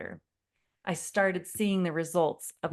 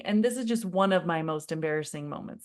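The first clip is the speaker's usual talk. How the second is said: ordinary talk, nothing marked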